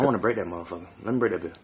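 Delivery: soft, low conspiratorial coaxing; hushed urging. Acted. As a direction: lean in and coax quietly.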